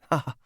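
small, restrained fake chuckle; polite waiter's laugh. A narrator's reading. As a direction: give a polite little laugh. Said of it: "laughed a polite little waiter's laugh".

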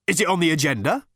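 a touch of impatient snapping; snapped. A narrator's reading. Interrupted man to Ford, snapped. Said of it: "snapped the man whom Ford had interrupted".

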